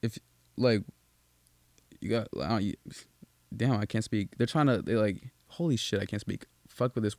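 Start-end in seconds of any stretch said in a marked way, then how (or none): none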